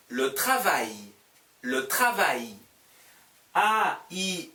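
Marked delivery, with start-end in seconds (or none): 0.1-2.6 "le travail" stretched out very slowly; slow model reading. Shown as a demonstration. Teacher modeling the new word, very slowly. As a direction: drag each syllable of "le travail".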